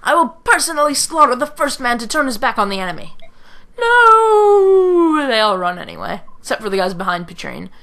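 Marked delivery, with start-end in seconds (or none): 0.0-3.2 loud, raised commander's order; rally the troops with a threat; theatrical furious officer; commanding shout. Acted. 3.8-5.2 high, drawn-out wail of "no"; hammed-up cry of defiance; long shouted no. Acted cry as troops break.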